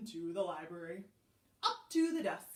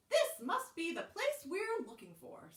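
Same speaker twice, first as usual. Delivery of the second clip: higher-pitched, lightly voiced hen; hen character; 8 semitones higher than usual. A narrator's reading. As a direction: read it as a hopeful hen, pitched up. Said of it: "said the hen".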